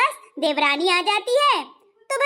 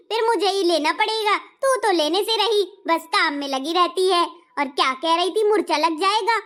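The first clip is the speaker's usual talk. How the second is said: ordinary talk, nothing marked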